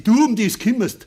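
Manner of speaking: high, loud angry retort; angry outburst. Acted. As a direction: bite back hard. Father snaps at meddling daughter.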